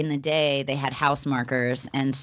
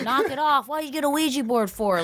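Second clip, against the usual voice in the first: higher, put-on rough mom voice; scolding mom; 10 semitones higher than usual. Mom scolding the kids over the Ouija board, put-on rough voice. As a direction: snap at the kids like a fed-up mother. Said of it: "the mom's like".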